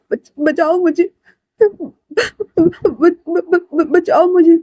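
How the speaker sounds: high, stammering plea for help; terrified stammer. Acted. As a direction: stutter out of fear, begging to be saved. (डरकर हकलाते हुए ऊँची आवाज़ में गुहार)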